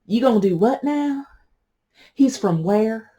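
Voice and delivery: a hint of an incredulous Southern relative; half-playful family asking in disbelief; shocked family. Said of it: "your family looked at you like you had eight heads".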